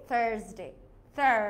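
American থার্সডে with a strong R; American "thurr", hold the R; American accent. Shown as a demonstration. Teacher showing the sounded R, American accent. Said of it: "if it's in an American accent".

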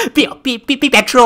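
high, loud beeping horn voice; horn noise. Pretend. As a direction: honk it like a car horn. Imitating horn for 打油.

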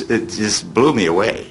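ordinary talk, nothing marked

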